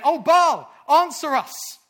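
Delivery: loud, high pleading cry; calling on Baal. Acted. Prophets calling on Baal from morning until noon, loud imploring cry. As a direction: cry out to a god at full voice. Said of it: "called upon the name of Baal".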